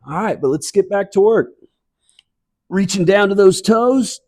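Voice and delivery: bright, lifted workout cues over music; fitness instructor cueing. Real, not acted. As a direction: call the moves like an aerobics coach. Fitness class movement cues.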